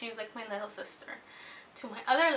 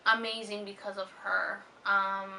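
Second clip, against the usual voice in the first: ordinary delivery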